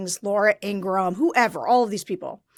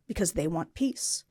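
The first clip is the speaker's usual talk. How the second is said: mock whisper; 7 semitones lower, 11 dB quieter than usual. Mocking MAGA peace talk.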